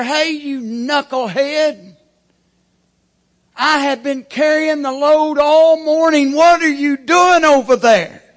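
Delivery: high, loud scolding yell at the lazy leg; mock scolding leg. Right leg yelling at the left leg, mock angry shout. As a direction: bawl out the slacker like an angry coworker. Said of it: "the right leg is screaming over there".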